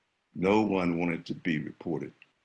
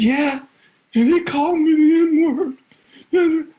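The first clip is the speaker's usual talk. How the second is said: high, loud distressed complaint; upset and crying; 12 dB louder than usual. Retold or imitated. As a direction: blurt it out to the principal. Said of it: "I was crying".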